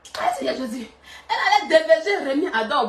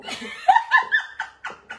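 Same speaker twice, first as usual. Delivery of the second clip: high laugh. Real, not acted. Teasing laughter in group.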